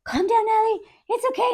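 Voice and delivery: high, soft coaxing; mock soothing. Staff calming the panicking patient, high soft mock soothing. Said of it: "And they're like".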